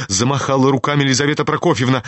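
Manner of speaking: usual speaking voice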